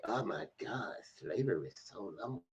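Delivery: soft, dismissive mock whine. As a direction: sneer it, imitating them. Mocking immigrants' dismissive line.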